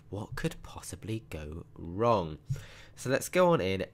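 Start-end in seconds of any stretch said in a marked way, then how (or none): 0.1-2.4 ominous whisper. Acted. Ominous aside about the school.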